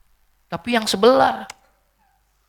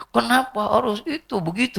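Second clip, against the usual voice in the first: mock lament; 11 semitones higher than usual. Acted widower lamenting.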